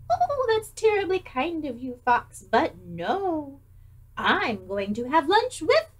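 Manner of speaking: a touch of a prim little mouse; polite little mouse. Acted. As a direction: turn the fox down sweetly. Mouse answers the fox.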